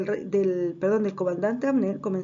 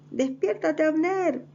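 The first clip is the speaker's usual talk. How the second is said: high, acted call to wake someone; wake-up shout; 8 semitones higher, slower than usual. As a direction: call out with the name drawn out. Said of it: "empezaba ahí a gritarle".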